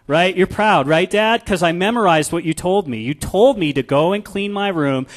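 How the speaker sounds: a hint of a proud little girl showing off; daughter's voice. Preacher voicing the daughter to her dad, eager child.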